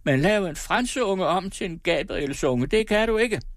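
put-on high shrieking old woman voice. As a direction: shriek it defiantly. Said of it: "skreg hun". (skrigende gammel kone)

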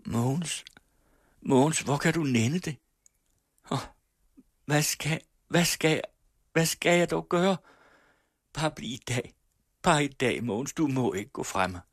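put-on a young woman crying as she begs; weeping plea. Laura begs Mogens not to leave her, tearfully pleading. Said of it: "Laura græd".

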